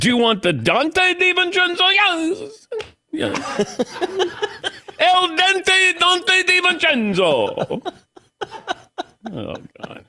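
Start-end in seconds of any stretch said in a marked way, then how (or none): 0.0-3.8 mock waiter exchange. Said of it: "go into a restaurant and say". 5.0-7.8 high, slow, laughing menu announcement; mock Italian dish name. Host names the player as a pasta dish, laughing. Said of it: "go into a restaurant and say".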